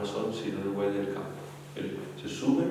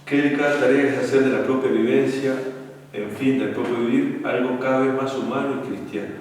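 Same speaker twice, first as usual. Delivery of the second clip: louder, weighty reading of a book passage; emphatic reading aloud; 4 semitones higher, 7 dB louder than usual. Acted. Priest reading a book passage to young couples, loud and emphatic. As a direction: read it out like a lesson to the youth.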